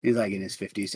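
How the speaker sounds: ordinary talk, nothing marked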